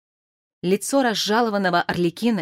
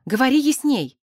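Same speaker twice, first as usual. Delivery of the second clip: higher, insistent demand; pitched-up, firm interjection; impatient interruption; 6 semitones higher, 3 dB louder than usual. A narrator's reading. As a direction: cut in, pressing for clarity. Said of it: "перебила она".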